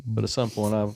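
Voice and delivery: ordinary delivery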